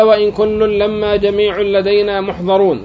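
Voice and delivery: slow, measured Quranic reading; slow verse recitation. Shown as a demonstration. Teacher reciting the verse to students, slow and measured. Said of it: "قال".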